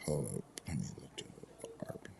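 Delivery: quick whispered aside; whispering. Real, not acted. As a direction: whisper it fast to yourself. Speaker checks phone mid-chat.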